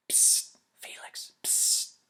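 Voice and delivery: unvoiced slow psst; hushed classroom whisper. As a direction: whisper to get his attention in class. Classmate hissing for attention.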